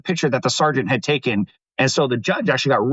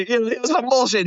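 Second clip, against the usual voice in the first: much higher, indignant repeat; outraged; 9 semitones higher than usual. Real, not acted. Guest bursts out, much higher, at the cop's lie. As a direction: throw up your hands at the lie.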